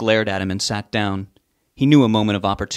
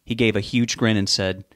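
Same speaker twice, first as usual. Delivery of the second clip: usual speaking voice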